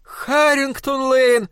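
high, slow booming wife's cry; wife cries out the street name. Acted. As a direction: cry it out, alarmed. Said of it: "вскричала она в ответ".

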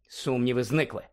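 usual speaking voice

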